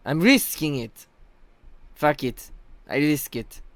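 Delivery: put-on rough go at a teammate's accent, stressed and spaced out; Chronicle's accent, roughly. Streamer mimicking teammate defending a risky plant, in his rough accent. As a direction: imitate a teammate's accent in short, separate bursts. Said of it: "I can't do his accent fully".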